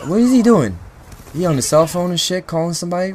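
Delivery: can't believe what he's seeing; incredulous outburst. Real, not acted. Teammate ignores him mid-fight.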